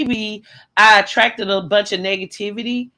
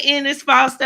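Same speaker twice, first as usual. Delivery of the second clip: normal talking voice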